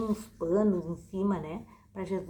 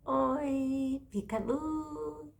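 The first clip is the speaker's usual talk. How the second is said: put-on kids show host; 7 semitones higher, much slower than usual. Greeting children, kids show opener.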